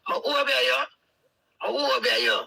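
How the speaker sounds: mimicking the other woman's taunt; mocking quote. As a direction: throw her taunt back, high and mocking.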